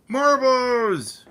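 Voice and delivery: high, loud call across the room; calling the cat. Real, not acted.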